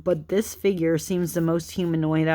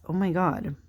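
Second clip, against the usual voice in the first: usual speaking voice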